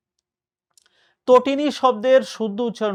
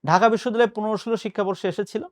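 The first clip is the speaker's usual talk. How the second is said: ordinary talk, nothing marked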